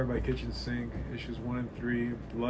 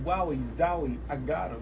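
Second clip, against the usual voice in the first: Borat impression; 3 semitones higher than usual. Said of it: "I feel like I sound like Borat".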